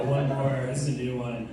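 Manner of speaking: ordinary delivery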